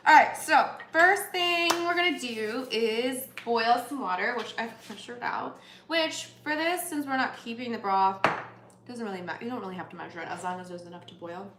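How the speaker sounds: ordinary talk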